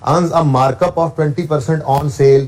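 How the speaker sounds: slow, deliberate reading; reading the variant wording slowly; slow dictation. Shown as a demonstration. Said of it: "अगर वो यूँ लिख दे".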